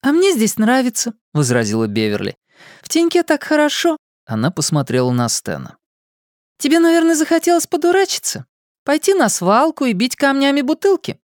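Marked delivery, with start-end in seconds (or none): 0.0-1.1 put-on high, young girl's voice; a lightly voiced young girl. 2.8-11.2 put-on high, teasing young girl. Beverly teasing Stan.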